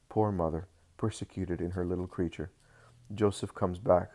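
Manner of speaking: plain reading voice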